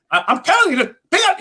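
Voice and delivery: high, fast, squealing comic rant; Chris Tucker voice. Shown as a demonstration. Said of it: "and then you become Chris Tucker".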